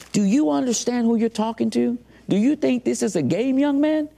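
very high-pitched, indignant imitation; retold fuming. Lawyer rebuking inmate who refuses deal, retold fuming. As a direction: act out an outraged, incredulous scolding. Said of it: "He was fuming".

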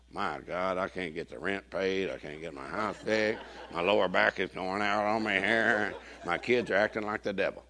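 soft, slow self-pitying moan; whiny complaining. Retold or imitated. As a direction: whine your troubles slowly like a defeated churchgoer. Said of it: "you're like".

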